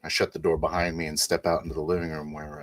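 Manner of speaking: ordinary delivery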